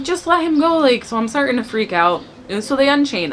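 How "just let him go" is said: high, urgent begging; desperate plea. Retold or imitated. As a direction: plead sharply, pitch jumping up. Begging them to release husband.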